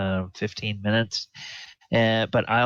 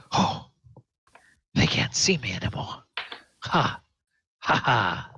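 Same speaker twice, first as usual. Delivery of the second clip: relieved fugitive. Acted. NPC thinks himself invisible.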